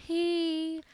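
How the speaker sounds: high, put-on "hey" of an online creep; put-on creeper voice. Woman imitating a random MySpace message sender, mocking. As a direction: pitch it way up, mocking a suitor. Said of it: "Don't do that voice".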